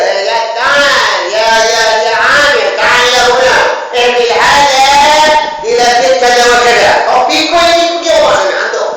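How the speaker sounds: loud, high summoning call; a ruler loudly calling his workers. Acted. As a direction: bark orders to the servants.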